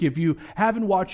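ordinary talk, nothing marked

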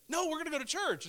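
high-pitched mock insistence; insistent. Retold insisting to sleepy wife.

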